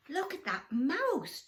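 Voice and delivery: loud, slow, excited monkey; chattering monkey. Acted. A monkey calls to the others, excited. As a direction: point and chatter. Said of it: "chattered a monkey".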